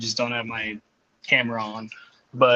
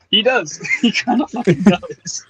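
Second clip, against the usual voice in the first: higher, laughing through the words; laughing agreement; 7 semitones higher, 4 dB louder than usual. Retold or imitated.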